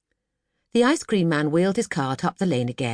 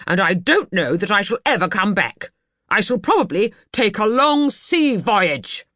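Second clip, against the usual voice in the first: stormy exit; 5 semitones higher, 5 dB louder than usual. Acted. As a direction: announce his departure in anger. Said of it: "he said".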